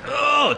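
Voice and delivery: high strained "oh"; dog straining on its leash; imitated choking gasp. Storyteller imitates a dog choking on its leash, high strained gasp. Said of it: "choking itself".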